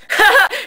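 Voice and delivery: high, loud mock laugh; prank caller's laugh. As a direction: cackle like a prankster after the punchline.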